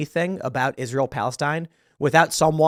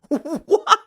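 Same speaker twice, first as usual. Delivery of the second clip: high why through a laugh; 12 semitones higher than usual. Real, not acted. Repeating the question to the headline, laughing. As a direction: laugh in disbelief as you ask.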